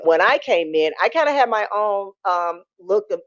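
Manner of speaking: ordinary talk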